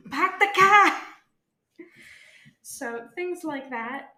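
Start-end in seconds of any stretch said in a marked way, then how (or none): 0.1-1.9 drawn-out, high Boston holler; thick Bostonian accent. Shown as a demonstration. Student hamming up dropped R's, shouted Bostonian accent. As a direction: belt it slowly in broad Bostonian, R's dropped. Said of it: "that thicker Bostonian accent where I start dropping my R's".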